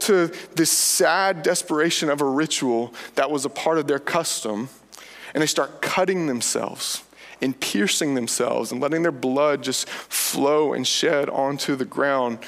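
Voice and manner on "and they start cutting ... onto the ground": ordinary talk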